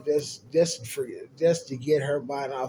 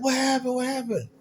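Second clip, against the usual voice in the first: mock alarmed friends; 11 semitones higher than usual. Friends rushing over to him.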